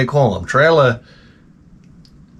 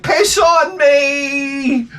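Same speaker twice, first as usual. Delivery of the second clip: high, long drawn-out mock holler; imitated gleeful shout; 11 semitones higher, much slower than usual. Comic imitating the man in the pool, high holler. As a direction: stretch it out on one high note.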